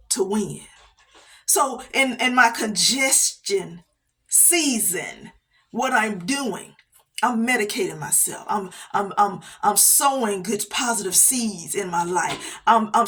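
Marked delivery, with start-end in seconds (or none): none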